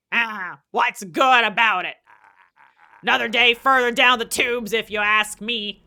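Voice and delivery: loud, gruff grumbling complaint; grumpy old man. Acted. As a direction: grouse like a sour old-timer. Grumpy townsman replies.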